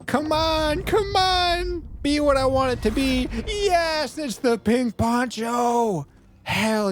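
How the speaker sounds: high, pleading build to a joyful cry; real excitement. Real, not acted. Player opening the long-awaited reward chest, high-pitched excitement. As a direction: beg in a high voice, then rejoice. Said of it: "I'm so excited".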